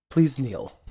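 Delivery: firm, formal order; solemn command. Acted. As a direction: lay down the command with ceremony. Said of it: "he looks at you and he goes".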